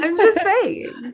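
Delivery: high, slow sing-song; mock innocent. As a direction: play innocent, stretched and high. Teasing after being called stupid.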